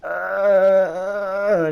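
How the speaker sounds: high, loud monotone groan; Tina groan. Retold or imitated. Kid making Tina's groan to escape, high and loud. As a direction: drone a long uh like a cartoon teen. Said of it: "literally making that noise".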